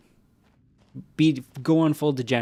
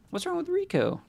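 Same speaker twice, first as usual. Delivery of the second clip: squeaky, much higher mocking tone. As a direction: parrot the question in a squeak. Mocking chat in high voice.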